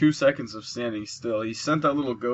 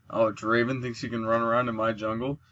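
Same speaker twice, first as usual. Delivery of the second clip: ordinary talk, nothing marked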